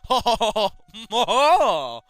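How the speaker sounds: loud high hammed-up villain laugh; over-the-top maniacal laugh; evil cackle. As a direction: cackle like a cartoon killer. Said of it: "I couldn't read that without laughing like that".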